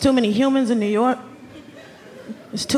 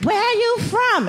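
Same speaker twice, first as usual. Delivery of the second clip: mock New Yorker; 9 semitones higher, slower than usual.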